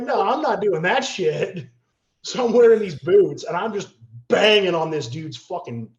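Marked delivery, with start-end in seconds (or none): 0.0-1.7 loud refusal. Retold or imitated. Refusing to fight barefoot.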